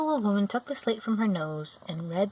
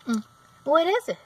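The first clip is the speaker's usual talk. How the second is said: higher, louder surprised cry; a sudden alarmed question; startled Munchkin; 8 semitones higher, 6 dB louder than usual. A narrator's reading. Munchkins spot the witch's vanished feet, startled.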